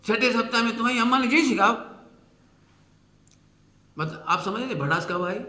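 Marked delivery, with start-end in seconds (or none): 0.8-2.0 angry taunt. Retold or imitated. Mother-in-law taunting bride.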